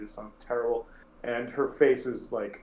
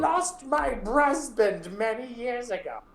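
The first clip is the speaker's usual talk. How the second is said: put-on much higher, slow, strange old woman voice; Hilda's terrible voice; 12 semitones higher than usual. Hilda introducing herself to the adventurers, high terrible voice. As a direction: drag it out like a gnarled old widow. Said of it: "their voices are terrible".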